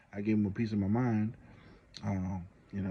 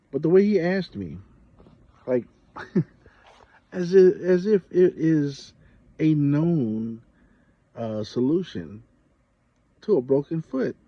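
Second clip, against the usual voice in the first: much higher and louder, half laughing in disbelief; incredulous outburst; 6 semitones higher, 9 dB louder, slower than usual. Real, not acted. Telling viewers how the nurse asked, high, loud disbelief. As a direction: climb up high like it's absurd.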